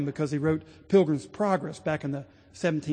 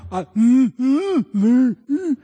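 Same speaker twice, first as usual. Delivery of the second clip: loud strained humming, higher; hammed-up choked-up mumbling; straining to speak; 4 semitones higher, 7 dB louder, much slower than usual. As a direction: strains but the word won't come. Man straining to say it.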